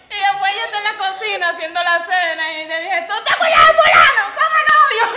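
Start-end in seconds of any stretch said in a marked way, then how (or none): 3.1-5.1 high, loud nervous blurt, half laughing; blurted confession. Retold or imitated. Girl blurting to her mom she has a boyfriend, high excited blurt. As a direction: blurt it out and bolt.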